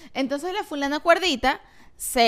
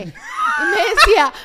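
laughing; 6 dB louder, much slower than usual. Real, not acted. Laughing while retelling the story.

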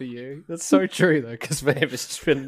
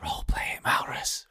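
whisper; 7 dB quieter than usual. Real, not acted. Whispered prompt to a player.

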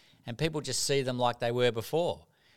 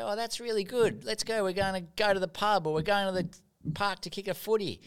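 cheery mates; 8 semitones higher than usual. Retold or imitated. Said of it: "And they'd say".

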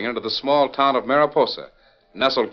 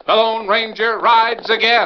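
slow dramatic announcer voice; 9 semitones higher, slower than usual. Acted. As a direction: proclaim it slowly and grandly. Announcer's opening title call.

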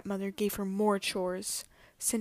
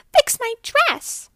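shrill high shout, much louder; a stepsister shouting orders; piercing bossy shout; 12 dB louder, slower than usual. A narrator's reading. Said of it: "shouted the other".

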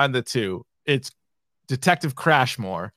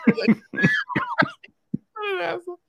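laughing; much slower than usual. Real, not acted.

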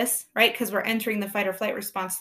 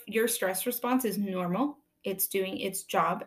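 ordinary talk

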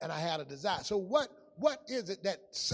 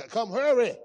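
urgent call; 3 semitones higher, 11 dB louder than usual. Retold or imitated. Urging Isaac to eat fast.

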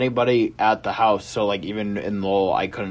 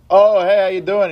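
much higher, loud friendly hello; bright loud greeting; 10 semitones higher, 4 dB louder than usual. Retold or imitated. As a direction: call out a cheery hi over the counter. Greeting the waving counter guy.

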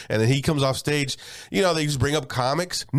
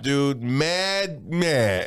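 normal talking voice

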